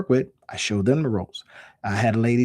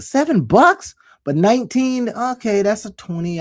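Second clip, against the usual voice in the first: much higher, incredulous mock customer voice; mock surprise; 9 semitones higher, 5 dB louder than usual. Shopper balking at a cheap price, high mock disbelief. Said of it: "I'll be like".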